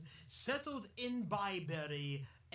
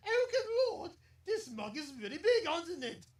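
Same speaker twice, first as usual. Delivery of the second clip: put-on posh English accent; 9 semitones higher, 7 dB louder than usual. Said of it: "I'm doing a little English accent".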